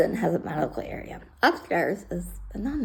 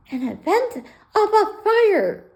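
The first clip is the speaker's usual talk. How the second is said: higher, slow official firefighter voice; 12 semitones higher, 6 dB louder, slower than usual. Retold or imitated. Firefighter lecturing the shelter, high slow official voice. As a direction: put on a stiff fire officer tone, high.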